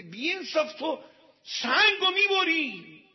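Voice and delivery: loud, high-pitched reproach; exasperated. Acted. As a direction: shout an outraged accusation, stretching the end.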